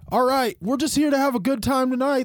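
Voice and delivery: [name]'s stage banter. Retold or imitated. Singer talking to the crowd between songs, high and loud. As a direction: hype up the crowd like a singer onstage. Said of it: "[name], he even goes".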